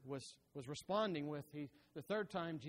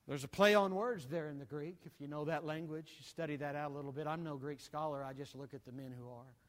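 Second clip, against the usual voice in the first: ordinary talk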